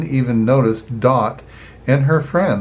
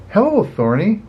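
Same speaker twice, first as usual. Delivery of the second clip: cheery Flick hello; 8 semitones higher than usual. A narrator's reading. Flick greets boss cheerfully.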